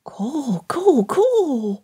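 put-on high, babbling little-child repetition; muttering toddler. A narrator's reading. Toddler to himself while playing in snow, muttering babble. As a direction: babble it to yourself like a tiny child. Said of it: "he muttered".

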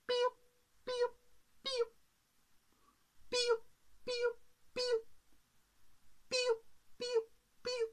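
tiny, very high cheeps; chirping bird. Acted. Bird flies past the balcony, high little cheeps. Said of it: "Por allí cruzó un pájaro".

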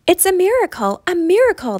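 the donkey feels his new strength; joyful cry. A narrator's reading. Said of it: "the donkey cried out".

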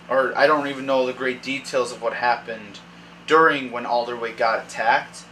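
ordinary talk

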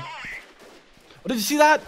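usual speaking voice

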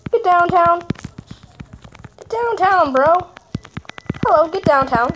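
usual speaking voice

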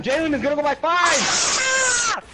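shouting. Real, not acted. Shouting at teammate in storm.

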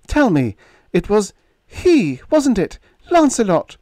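high, eager and intense; breathless hope in a high voice. A narrator's reading. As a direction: press him urgently for the truth. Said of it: "Her voice was intense, eager".

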